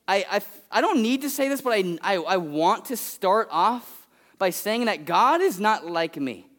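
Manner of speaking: ordinary talk, nothing marked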